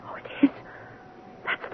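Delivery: breathless horrified whisper; frightened hush at the sight of the madwoman; shocked whisper. Acted. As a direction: gasp the words out under her breath. Jane sees the madwoman, gasps.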